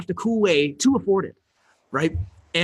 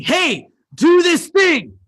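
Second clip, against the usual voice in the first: much higher, louder and slow, drawn out; calling out; 10 semitones higher, 7 dB louder, much slower than usual. Retold or imitated. Coach telling program members what to do, loud and drawn out. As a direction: stretch it out like shouting across a room. Coach giving students an instruction.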